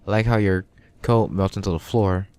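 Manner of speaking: usual speaking voice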